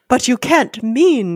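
hammed-up appalled gasp, slowly; gasped disbelief. A narrator's reading. Hunter protesting to the general, gasped. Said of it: "gasped Rainsford".

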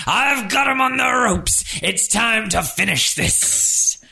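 high, boastful wrestler call; cocky wrestler. Acted. The Battletoad taunting to win the crowd back, boastful. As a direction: gloat like a showman in the ring. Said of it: "he's gonna try and win the crowd back".

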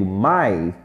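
each word said alone, with a pause after it; slow teacher drill, word by word; drilled word list. Shown as a demonstration. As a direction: model every word for the class to repeat. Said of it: "Repete comigo".

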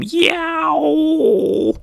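very high long "yow" sound effect; cartoonish high yowl; imitated blast yowl. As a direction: wail the blast noise high and drawn-out. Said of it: "He even makes the sound".

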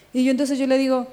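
normal talking voice

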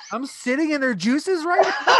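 high, loud appalled question; hammed-up disbelief, nearly shouting; incredulous outburst. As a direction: act out horrified realization through laughter. Acts out his disgusted realization.

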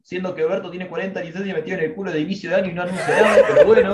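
fast, laughing through the words; laughing while talking. Real, not acted. Teasing the publisher to friends, laughing. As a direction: giggle as you mock him.

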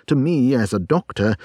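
ordinary delivery

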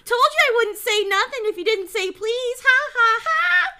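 high, loud crowing with mock laughter; gloating taunt. Peeves rubbing in his trick on Filch, gloating. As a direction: crow over the victim in triumph.

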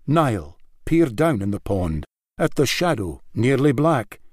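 usual speaking voice

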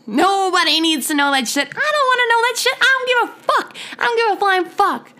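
high-pitched, fed-up outburst; exasperated rant. Real, not acted. Podcaster does not want to hear it, exasperated outburst. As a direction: let the exasperation climb sharply in pitch. Ranting that she doesn't care.

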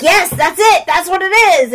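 acted delighted confirmation; retold eureka. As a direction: jump on the answer excitedly. Rose seizes on the answer.

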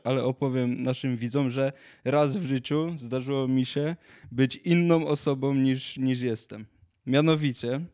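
normal talking voice

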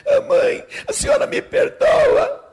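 put-on high, loud childish wail; hammed-up sobbing plea; tearful, anxious little girl. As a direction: beg forgiveness like a crying child. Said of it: "a menina tava super nervosa".

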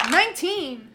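eagerly calling out a dice roll; jumping in early, excited. Real, not acted. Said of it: "I was just excited for once".

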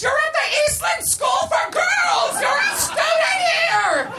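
very high, slow screech; screaming. A narrator's reading. Missus Garrett shakes the tripping Tootie, screaming. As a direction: scream each word in someone's face. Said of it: "screamed in her face".

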